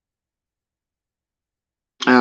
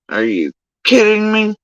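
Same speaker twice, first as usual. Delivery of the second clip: retold outrage; 10 semitones higher than usual. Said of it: "I was heated".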